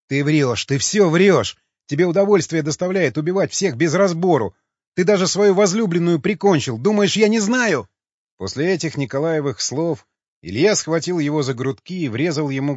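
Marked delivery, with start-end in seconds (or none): 0.1-7.9 loud, high, mounting accusation; angry accusation. A narrator's reading. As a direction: hurl the charges, climbing higher to the end. Tearful man hurls accusations.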